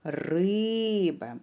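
риба with a long, held ри; ри-и-иба, short final ба; stress on first syllable. Shown as a demonstration. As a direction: call out риба, stretching the stressed ри. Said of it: "наголошений буде перший склад".

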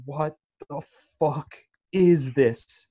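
slow, drawn-out bewilderment; stunned bewilderment. Retold or imitated. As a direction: draw the words out as if unable to believe it. Retells waking up to video.

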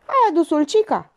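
high-pitched demand; pointed, high question; sharp challenge. A narrator's reading. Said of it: "o interpelă pe Elena".

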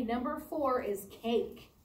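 ordinary talk, nothing marked

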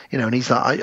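ordinary delivery

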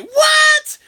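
very high, loud surprised shout; shocked what. Retold or imitated. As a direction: yelp it in disbelief. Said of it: "And I'm like".

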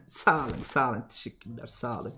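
very low, very soft thanks; murmured thanks. Real, not acted. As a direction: murmur your thanks, barely audible. Mock-humble thanks to the audience.

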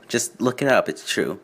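usual speaking voice

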